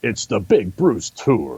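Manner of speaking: slow stretched announcer voice; lightly voiced monster truck announcer. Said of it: "the monster truck announcer has to do that one".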